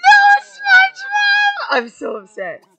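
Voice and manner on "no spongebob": very high, loud, drawn-out mock sobbing shouts; hammed-up wailing grief. Real, not acted. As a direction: overact the grieving friend, wailing. Mourning dead SpongeBob, hammed up.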